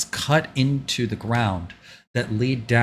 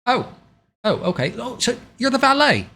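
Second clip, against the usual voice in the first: high-pitched, apologetic official; high voice, politely flustered; game character greeting valet; 9 semitones higher, 6 dB louder than usual. Acted. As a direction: play a high-voiced character recovering from a mix-up.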